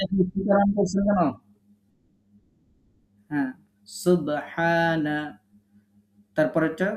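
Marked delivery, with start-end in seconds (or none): 3.9-5.2 সুবহানা very slow, with the b bounced; teacher spelling out সুবহানা slowly; slow qalqala demo. Shown as a demonstration. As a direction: drag out সুবহানা and jolt the b. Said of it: "কলকলা করেন".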